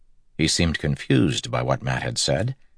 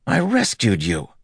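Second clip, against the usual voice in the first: higher indignant protest; 8 semitones higher than usual. Acted. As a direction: state it in disbelief. Matt indignant at the attacker.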